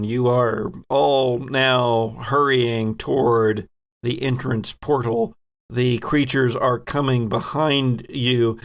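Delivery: ordinary delivery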